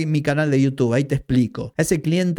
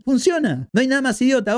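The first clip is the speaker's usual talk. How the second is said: incredulous outburst; 10 semitones higher than usual. Real, not acted. Ranting about unboxing videos.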